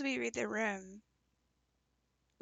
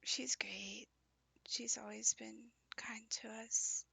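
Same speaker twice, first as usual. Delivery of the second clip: whispering; 5 dB quieter than usual. Real, not acted. Speaker whispers all lines.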